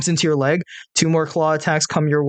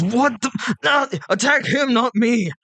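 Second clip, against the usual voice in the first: alarmed shout; 9 semitones higher, slower than usual. Acted. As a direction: yell it out, outraged and betrayed. Hippo yells at ally raptor.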